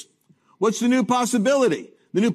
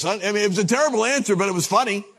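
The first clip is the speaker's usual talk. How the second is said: ordinary talk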